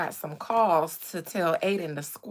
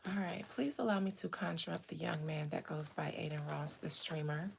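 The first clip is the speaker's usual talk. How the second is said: spirit invocation; 12 dB quieter than usual. Real, not acted. Streamer asking to conjure up a young man, hushed invocation. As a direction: summon the spirit quietly.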